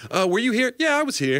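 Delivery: high-pitched acted exchange; mock questioning. Cop questioning a man loading trucks, mock high voices. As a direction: pitch both question and answer way up.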